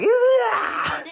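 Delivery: very high shouted shriek; girl's scream. Acted. Girl startled as the blanket bites her, high shriek. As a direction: shriek in sudden fright.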